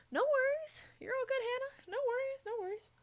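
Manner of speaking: very high, singsong no-problem voice; light, high and chirpy; high-voiced reassurance. Real, not acted. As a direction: reassure in a squeaky high pitch. Reassuring a chat viewer.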